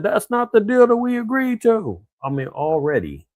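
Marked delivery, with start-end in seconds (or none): 0.0-2.0 mock angry protest, pitched way up; imitating a furious president, higher and louder; retold fuming. Trump complaining about the broken deal, mock fuming. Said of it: "And Trump was fuming".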